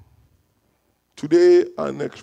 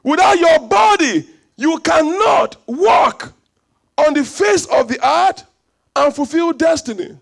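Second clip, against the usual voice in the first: higher, louder, fervent preaching; full-force preaching; 7 semitones higher, 4 dB louder than usual. Acted. Pastor preaching to the congregation about being God's image, fervent shout. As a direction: lift the room with a fervent cry.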